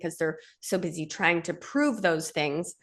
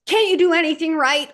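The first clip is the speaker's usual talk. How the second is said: higher, louder reproach; scolding mom; 9 semitones higher, 6 dB louder than usual. Retold or imitated. Mother snapping at her child, loud and high. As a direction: bark the childhood put-down. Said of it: "your mom said".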